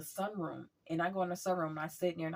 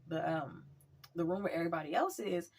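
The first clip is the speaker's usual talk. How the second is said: normal talking voice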